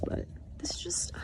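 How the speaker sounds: soft, breathy whisper of delight. Real, not acted. Hiker whispering to the camera about the cute view. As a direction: whisper it like a secret treasure.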